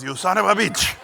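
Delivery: mock outrage in a thick Hungarian accent. Hungarian teacher astonished by hidden paintings, loud accented outburst. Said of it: "thick accent".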